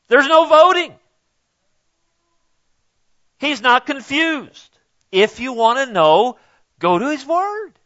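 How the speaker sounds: high, emphatic insistence; fervent preaching. Real, not acted. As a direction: drive it home for the church.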